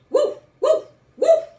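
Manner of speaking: loud, high yapping dog barks; dog barking. Acted. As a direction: bark boldly like a protective dog. Dog Duke barks at stranger.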